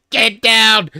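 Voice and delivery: loud high yell of alarm; Secret Service shout. Retold or imitated. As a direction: bark the warning at the president.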